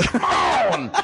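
bursting into laughter over come on; can't hold back the laugh, high and giggly; real laughter. Real, not acted. Brother cracks up at the rehab cake story, laughing hard.

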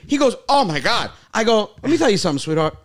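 high, loud, revved-up rant; hammed-up tough-guy retort; fired-up retold comeback. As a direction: tear into her, way up and loud. Retelling heated comeback to old lady.

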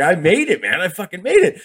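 imitated jubilation, high-pitched; elated. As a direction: celebrate as if you just struck it rich.

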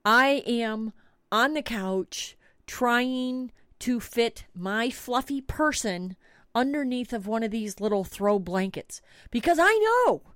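normal talking voice

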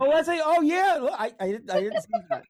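high, loud delighted exclamation; thrilled surprise; excited. Real, not acted. Spots fan's Mickey merch.

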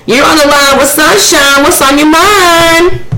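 high, sugary call-in host; radio host voice. Pretend. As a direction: welcome the caller like a DJ. Hosts pretend radio call.

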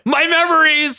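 high-pitched, melodramatic wail; crying out. Acted. Logan grabs his head as memories hit, crying out. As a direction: cry it out in a high, strained voice. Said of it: "Logan cried out".

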